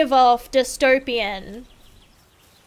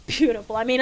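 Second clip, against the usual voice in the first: usual speaking voice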